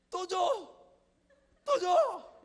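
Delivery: put-on high, shrill-loud little-kid yell; toddler demanding. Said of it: "이렇게".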